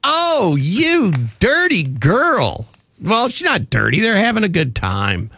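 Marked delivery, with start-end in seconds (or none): 0.1-2.7 mock scolding. Real, not acted. Teasing reaction to quote.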